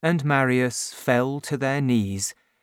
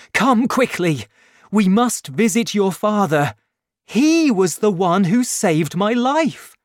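higher and louder, breathless hurry; urgent excitement; 8 semitones higher, 6 dB louder than usual. A narrator's reading. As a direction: hurry her out the door. Marius rushing to Cosette.

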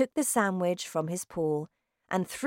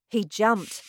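ordinary delivery, nothing marked

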